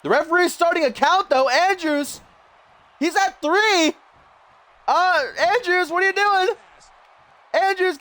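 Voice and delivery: high-pitched, urgent play-by-play; alarmed excitement. Real, not acted. Commentator urging Andrews out before the five count, frantic excitement. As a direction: voice shoots up in real alarm at the count.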